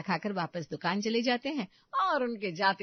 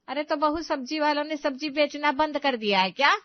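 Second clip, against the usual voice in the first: loud, higher-pitched sarcastic retort; exasperated mother-in-law; 7 semitones higher, 7 dB louder than usual. Acted. Mother-in-law retorting about missing onions, loud exasperation. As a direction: throw the excuse back at her, loudly.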